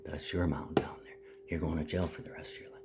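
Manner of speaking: menacing whisper of a threat; taunting whisper. Acted. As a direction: breathe the threat right at them. Taunting threat after prison mention.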